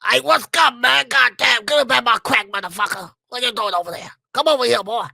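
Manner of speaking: put-on old O G gangbanger voice; high, loud, throat-shredding gangbanger shout; Smokey. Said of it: "like the old O G gangbanger type style".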